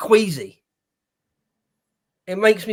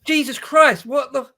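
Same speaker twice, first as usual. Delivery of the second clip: higher, louder disbelief; exasperated outburst; 7 semitones higher, 4 dB louder than usual. Real, not acted. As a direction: vent real exasperation at the mayor. Outraged reaction to mayor's answer.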